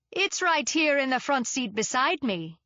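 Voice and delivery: a hint of a West Indian accent; lilting West Indian accent. A narrator's reading. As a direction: lilt it gently like a West Indian driver. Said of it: "in his lilting accent".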